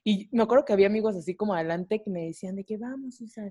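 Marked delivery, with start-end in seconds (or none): 2.8-3.5 very soft, higher cheering-on; hushed encouragement. Retold or imitated. Friends urge her on mid-presentation, hushed. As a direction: imitate friends urging under their breath.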